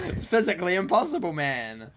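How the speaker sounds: high, loud amused disbelief; loud laughing protest. Real, not acted. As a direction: protest loudly through laughter. Laughing protest at one-syllable claim.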